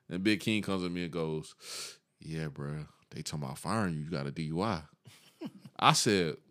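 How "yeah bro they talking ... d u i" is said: low, soft tip-off in a near hush; a coworker quietly tells him he'll be fired; hushed warning. Retold or imitated. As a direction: lower and softer, like passing on a secret. Big King tipping him off quietly.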